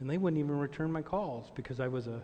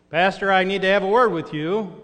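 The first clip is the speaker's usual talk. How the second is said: loud call from outside the door; 5 semitones higher, 13 dB louder than usual. Retold or imitated. Congregant calling for the pastor from outside his office, loud. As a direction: project it through the door, higher.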